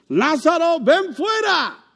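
high, loud, slow cry of "Lázaro, ven fuera"; loud shout. Acted. Jesus calling dead Lazarus out of the tomb, loud shout. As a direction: call him out of the tomb with full force. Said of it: "gritó con fuerte voz".

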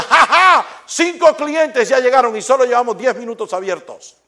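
loud, high crowing laugh and boast; gloating shopkeeper. Retold or imitated. Shopkeeper yelling across the street at his rival, gloating. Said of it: "uno le decía".

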